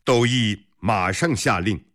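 plain reading voice

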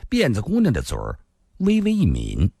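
usual speaking voice

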